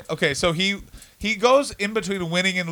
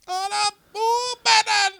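very high, drawn-out comic French voice; mock French accent; slower than usual. Comic repeating the bit on request, in a shrieky mock French accent. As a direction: squeal it slowly in a silly French accent. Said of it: "Do it again".

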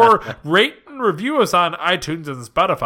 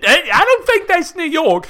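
put-on Cockney-Australian accent; 12 semitones higher, 6 dB louder than usual. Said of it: "Cockney and Australian".

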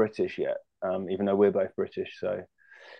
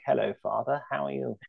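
put-on creepy posh kid; 4 semitones higher, 6 dB quieter than usual. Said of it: "sounds like a creepy kid who'd haunt you".

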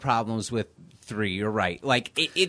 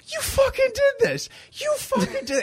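overacted anguished yell. Cop bleeding on the floor accusing the killer, hammed-up crying yell. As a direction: howl the blame like a hammy dying cop. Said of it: "and he goes".

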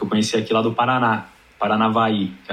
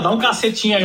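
raised-pitch mock bakery order; innocent order; 9 semitones higher than usual. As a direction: tourist ordering bread in São Paulo. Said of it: "Aí você chega em São Paulo e fala".

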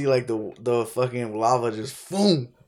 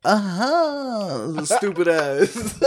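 laughing; 11 semitones higher, much slower than usual. Real, not acted. Teasing insult amid laughter.

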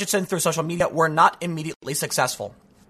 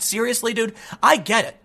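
ordinary delivery

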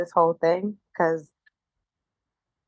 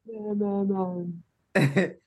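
fading echo; 3 semitones lower, 6 dB quieter, slower than usual. Pretend. Echoing the joke, fading out.

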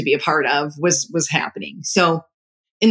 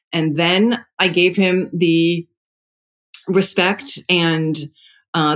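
normal talking voice

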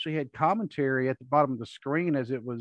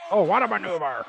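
lightly voiced announcer; a hint of old-school ring announcer; Vince McMahon announcer voice; 5 semitones higher, 4 dB louder than usual. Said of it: "he had a different sounding voice".

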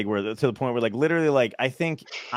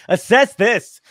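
sharp loud snap back; angry retort; 7 semitones higher, 8 dB louder, much slower than usual. Retold or imitated. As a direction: shout it defiantly, higher. Retold outburst at calm colleagues.

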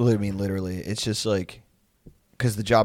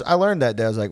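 ordinary talk

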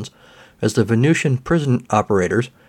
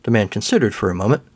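ordinary delivery, nothing marked

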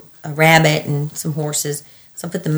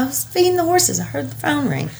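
innocent excuse; 9 semitones higher, 4 dB quieter than usual. Retold or imitated. Her excuse to husband.

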